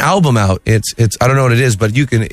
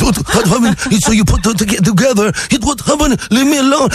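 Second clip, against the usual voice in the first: higher, frantic mock dubbed-movie voice; lightly voiced mock Jackie Chan; 8 semitones higher than usual. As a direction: play a badly dubbed kung fu star. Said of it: "Jackie Chan, of course".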